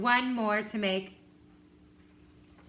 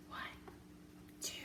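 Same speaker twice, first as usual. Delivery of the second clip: counting under breath; 23 dB quieter than usual. Shown as a demonstration. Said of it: "and I'm gonna whisper count".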